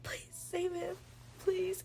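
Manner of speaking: high, imploring mock plea; retold begging. Heroine begs Tamlin to save him, retold begging. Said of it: "She was, like, begging him".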